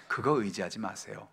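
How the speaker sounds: ordinary delivery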